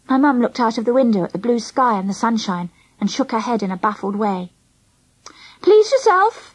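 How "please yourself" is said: high, offhand reply; shrugging dismissal. A narrator's reading. Mum giving up on questioning, shrugging. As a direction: toss it off with a shrug. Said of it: "she shrugged".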